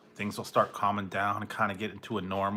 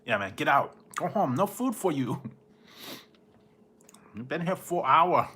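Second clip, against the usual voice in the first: raised, playful shooing off with a laugh; mock scolding; 5 semitones higher than usual. Teasing a visitor who overstayed, mock scolding. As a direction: pretend to chase a friend out, half laughing.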